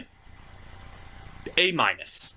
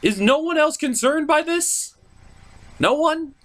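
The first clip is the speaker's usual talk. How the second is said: incredulous plea; 12 semitones higher than usual. Real, not acted. Begging the audience to agree.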